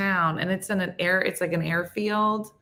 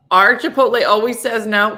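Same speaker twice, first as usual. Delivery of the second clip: louder, higher mock outrage; loud complaint; 4 semitones higher, 8 dB louder than usual. Real, not acted. Griping to cohosts about Chipotle, louder and higher. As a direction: blurt out the grievance.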